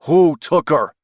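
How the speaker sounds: loud sharp demand. Acted. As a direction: bark the question. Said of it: "Doug demanded".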